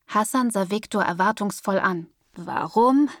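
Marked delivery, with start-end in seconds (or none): 2.3-3.1 skeptical. A narrator's reading. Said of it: "Die Skepsis in Viktors Stimme".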